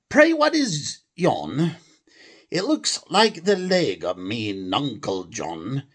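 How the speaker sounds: high, slow mock English accent; hammed-up English accent as Tom. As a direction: play Tom, curious and English. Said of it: "a normal English accent".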